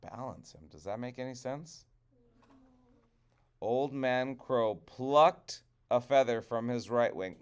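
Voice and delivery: plain reading voice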